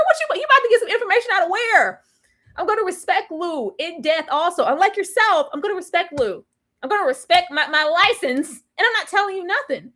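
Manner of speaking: high, indignant clapback; sassy therapist. Retold or imitated. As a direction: put her in her place, high and sharp. Therapist scolding the nosy client.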